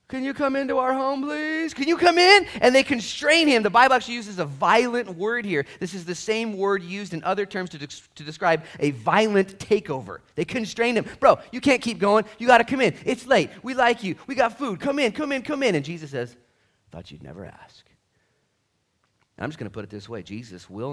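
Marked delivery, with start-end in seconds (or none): 0.1-2.4 lightly voiced begging host; mock pleading invitation; pleading disciples. 11.2-15.7 hammed-up pushy friends; loud, high, won't take no; mock urging invitation; insistent disciples. 16.9-17.9 softer, lower, sly answer; understated knowing Jesus; coy reply. Retold or imitated. Jesus replies coyly.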